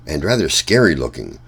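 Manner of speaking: usual speaking voice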